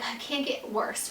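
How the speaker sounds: whispered fearful aside; dreading whisper. Retold or imitated. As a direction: breathe it out in dread.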